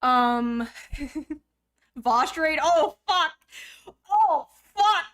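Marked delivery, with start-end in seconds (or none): 2.6-5.1 loud, high startled curse with a laugh; real startled outburst; surprised swearing. Real, not acted. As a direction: caught off guard by a raid. Surprise raid arrives.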